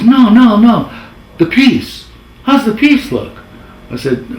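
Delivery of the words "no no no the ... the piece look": high, loud crooner voice on the phone. Retold or imitated. [name] phoning his lone editor, high, loud crooner impression. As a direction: ham up the famous old singer calling in. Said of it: "That's how I talk, kinda".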